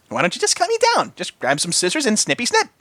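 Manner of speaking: high, sweet pony voice; cheerful Pinky. A narrator's reading. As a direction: suggest it brightly. Pinky suggests cutting.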